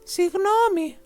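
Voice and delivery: high, loud sorry; called apology. A narrator's reading. Boy calling sorry to the hiding dragon, high and loud. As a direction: call out the apology. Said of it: "φώναξε".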